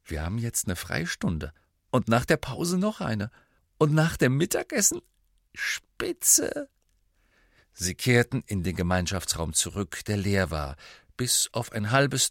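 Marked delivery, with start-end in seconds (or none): 0.0-6.7 rising glee, ending in a high, airy cry; delighted. A narrator's reading. Ron shows his friend his timetable with free periods, overjoyed. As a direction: build delight towards a squeaky, whispery finish. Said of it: "sagte Ron hocherfreut".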